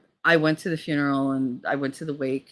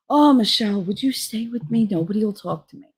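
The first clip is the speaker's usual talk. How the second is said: mildly plaintive imitation, raised pitch; upset old father; 5 semitones higher than usual. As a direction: plead a little, as an upset father. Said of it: "he's all upset".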